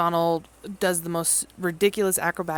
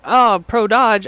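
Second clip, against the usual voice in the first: mock Nigma; 5 semitones higher, 12 dB louder, much slower than usual. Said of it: "Nigma's like".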